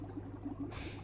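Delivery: barely audible villain titter; faint, knowing laugh; very soft chuckle. Acted. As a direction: chuckle under your breath. Villain amused, moving on to hypnosis.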